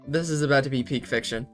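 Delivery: ordinary talk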